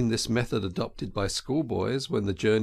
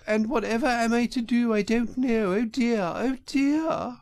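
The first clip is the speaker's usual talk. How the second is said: high, hammed-up woeful wail; crying Toad as washerwoman; 11 semitones higher than usual. A narrator's reading. Poor washerwoman without a ticket begs the driver, tearfully high. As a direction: plead piteously in a put-on high woman's voice. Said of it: "crying afresh".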